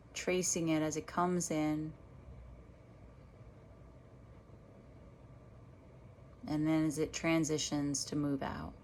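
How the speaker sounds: unhurried meditation guide with long pauses; guided meditation. Real, not acted. Meditation teacher guiding listeners back to their breath, guided meditation voice. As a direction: lead them gently through each breath, leaving space between phrases.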